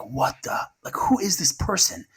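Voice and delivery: astonished, as if nobody could be that kind; amazed disbelief. Retold or imitated. Family amazed by soldier's call.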